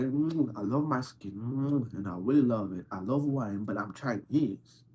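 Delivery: normal talking voice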